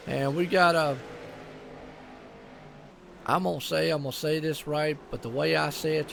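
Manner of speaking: normal talking voice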